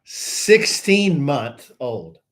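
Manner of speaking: put-on slow, deliberate sixteen month old; careful tongue twister. Shown as a demonstration. Host slowly works through a tongue twister on air. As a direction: spell out each syllable of the tongue twister. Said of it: "it's a tongue twister".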